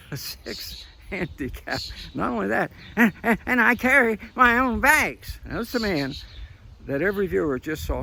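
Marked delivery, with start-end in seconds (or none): 2.1-5.4 high, loud mocking old man; Biden impression. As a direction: shout a boast like a cranky old man. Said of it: "starts screaming at".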